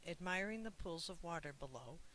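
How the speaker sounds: ordinary delivery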